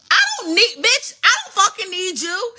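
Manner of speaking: high, emphatic mock outburst; defiant retort. Acted quarrel, Israel snaps back.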